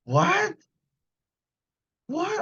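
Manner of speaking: a startled yelp of what; shocked disbelief. Real, not acted. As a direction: jump up in pitch in sheer disbelief. Shocked reaction to chat news.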